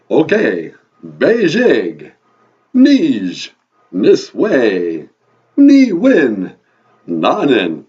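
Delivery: booming vampire counting; each number loud and swooping down, Transylvanian accent. Acted. Count repeating the host's Ojibwe numbers, booming vampire accent. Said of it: "your vampire accent".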